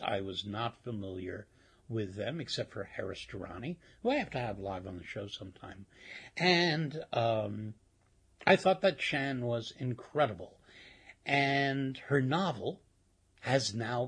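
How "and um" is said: usual speaking voice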